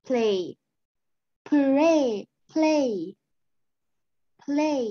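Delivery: "play" with a clean l sound, not r; clear l. Shown as a demonstration. Teacher drills the l in "play": repeated clean model. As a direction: say "play" with the tongue on the ridge for the l. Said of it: "this one too".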